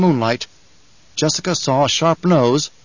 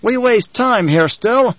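high, slow call from above; 9 semitones higher, 3 dB louder, slower than usual. A narrator's reading. Man in the rocks urges his leader on, calling down. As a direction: call impatiently across the rocks. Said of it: "The voice from the rocks above them called".

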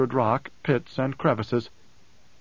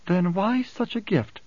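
higher, slow, searching question; probing; 7 semitones higher, slower than usual. A narrator's reading. Alia presses Hayt about the gift, probing. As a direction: press him for the hidden reason. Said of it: "she probed".

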